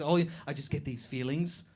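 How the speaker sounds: coy and self-important, lightly hammed-up; a stranger claiming a bit of psychic gift, softly; mock mystic. Imitating a would-be psychic.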